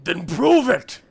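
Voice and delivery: loud booming challenge; echoed giant voice. Acted. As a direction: throw the challenge across the cave. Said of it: "I'll just echo one more time".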